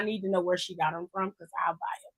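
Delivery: whispered aside. Real, not acted. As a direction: whisper it to yourself. Quiet aside about buying shoes.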